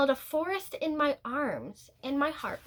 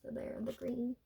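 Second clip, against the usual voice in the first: hushed aside; 11 dB quieter than usual. Real, not acted. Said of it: "aside pointing at picture".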